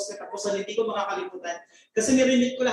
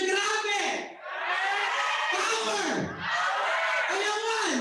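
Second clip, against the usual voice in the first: retold shout; 9 semitones higher, much slower than usual. Recalling the crowd at her first seminar, shouting. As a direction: yell it like a cheering crowd at a seminar. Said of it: "may sumigaw na".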